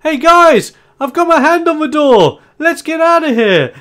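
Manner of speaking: high, loud eager call to friends; hammed-up cheerful NPC yell; excited shout. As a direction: shout it out, thrilled to be almost free. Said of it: "he looks back to you guys going".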